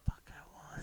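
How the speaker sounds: hushed whisper, no voice at all. Pretend. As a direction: breathe the words in a whisper. Whispering into the mic for fun.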